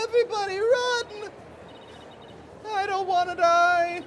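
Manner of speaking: shrill panicked screams; panicked screaming. Acted. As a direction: scream for your life. Panicking crowd voices.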